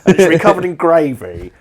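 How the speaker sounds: a glutton barking an order at a machine; greedy replicator order. Pretend.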